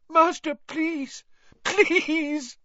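high, slow weeping plea; sobbing plea. A narrator's reading. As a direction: beg through tears. Wormtail begging weeping.

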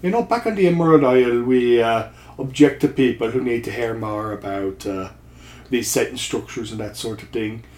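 put-on a would-be Scottish accent that comes out Irish; bad Irish accent. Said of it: "sounding like a very bad Irish accent".

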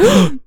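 sharp high gasp of shock; mock shocked gasp. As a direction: gasp like someone caught off guard. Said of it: "He went".

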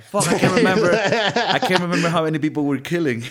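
mock panicked henchman. Stalin's crony losing count of killings, laughing panicked yell. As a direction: blurt it out in a giddy fake panic, cracking up. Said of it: "it's like".

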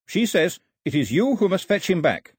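Carley's voice. A narrator's reading. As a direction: voice Carley relaying the old woman's words. Carley relays old woman.

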